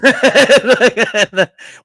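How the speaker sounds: big high burst of laughter; really cracking up; loud laugh. Real, not acted. Laughing hard after the embassy story.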